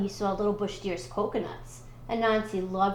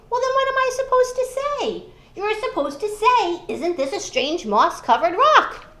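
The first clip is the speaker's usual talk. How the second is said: high, loud exasperated shout; frustrated yelling; 9 semitones higher, 7 dB louder than usual. Acted. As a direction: blow up in exasperation. Anansi and Deer arguing.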